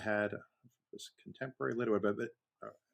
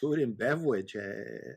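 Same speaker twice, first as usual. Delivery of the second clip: cartoon hunter voice with w for r; Elmer Fudd. Cartoon hunter hosting a cooking show, goofy impression. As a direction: do a silly cartoon speech impediment. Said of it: "like, Elmer Fudd making a cooking show".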